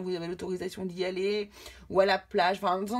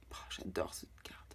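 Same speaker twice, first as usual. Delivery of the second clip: whisper; 16 dB quieter than usual. Real, not acted. Admiring the drawn card aside.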